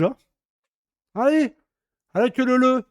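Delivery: usual speaking voice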